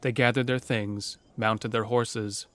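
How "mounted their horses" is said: ordinary talk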